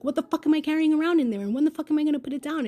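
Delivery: fast, high, loud self-scolding; upset with self. Retold or imitated. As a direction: rant at yourself, angry and racing. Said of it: "I was upset with myself".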